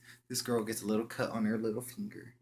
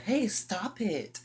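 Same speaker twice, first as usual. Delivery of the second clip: put-on very high, slow, drawn-out girl voice; mock girly stop it; girly protest; 13 semitones higher, 5 dB louder, much slower than usual. Girl telling boy to stop.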